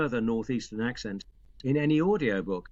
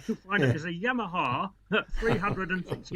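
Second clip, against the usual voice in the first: lightly mimicked pernickety caller, a little higher; pedantic phone-in listener; 4 semitones higher than usual. Listener phones the BBC about a motorcycle sound, mock pedantry. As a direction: politely correct them like a motorbike buff. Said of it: "And they said".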